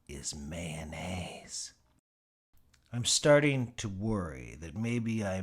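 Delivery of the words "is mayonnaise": slow whispered reveal; secret whisper. Acted. Telling the reader the secret ingredient, slow whisper.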